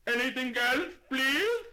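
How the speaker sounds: high, very loud shopkeeper shouting; ear-splitting shout. Acted. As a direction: bellow it at a deaf old lady. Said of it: "lifted his voice to an ear-splitting shout".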